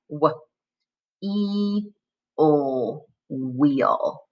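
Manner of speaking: W, E, O split apart then blended into wheel; sound by sound. Shown as a demonstration. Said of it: "break it into its component parts".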